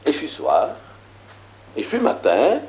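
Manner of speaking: measured, declaiming biblical verse; solemn scripture reading. Acted. Teacher reading Genesis aloud to the class, solemn scripture reading. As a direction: read it like sacred text, weighty.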